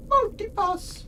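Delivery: high squeaky attempt at her accent on Multipass; attempted film accent. Gamer imitating a film character's accent on Multipass. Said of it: "I can't do her, her accent".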